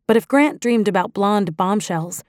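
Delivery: usual speaking voice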